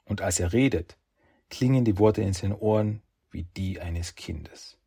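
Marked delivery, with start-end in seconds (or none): none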